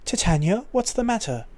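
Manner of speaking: put-on a light British accent; a little British. Concerned mercenary asking his commander, light British accent. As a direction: dial the British accent back to a touch. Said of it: "just a little bit British".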